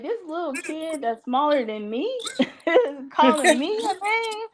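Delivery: high, laughing disbelief; outraged amused surprise, rising high; incredulous. Retold or imitated. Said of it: "but I was like".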